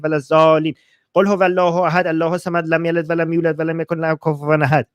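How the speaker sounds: Quran verses rattled off very fast; speedy, even recital from memory; rapid recitation. Shown as a demonstration. Answering a viewer's request for a verse in Arabic, rapid recitation. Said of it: "Read us a verse in Arabic".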